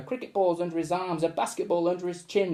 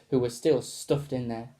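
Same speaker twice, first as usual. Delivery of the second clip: plain reading voice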